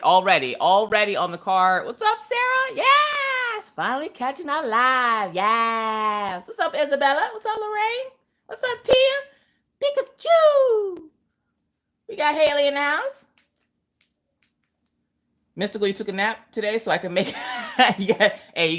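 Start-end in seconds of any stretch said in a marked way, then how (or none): 1.9-13.3 much higher bright greeting to each chatter; high sing-song greetings. Acted. Host greeting her live chat in a high sing-song voice.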